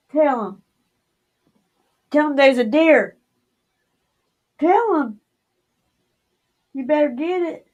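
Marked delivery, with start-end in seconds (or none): 2.1-7.7 insistent higher urging. Real, not acted. Urging her partner to tell viewers about the deer, insistent. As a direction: push it up, pressing them to speak.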